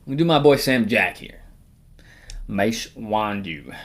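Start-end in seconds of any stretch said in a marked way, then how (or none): 2.5-3.8 drawn-out name; slow and dramatic. Pretend. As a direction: stretch each syllable out.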